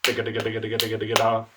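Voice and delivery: "ticka" rattled off in an even stream; quick machine-like "ticka" syllables on one pitch; rapid double tonguing. Shown as a demonstration. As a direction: run "ticka" nonstop, landing on "da". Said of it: "learn to double tongue like this".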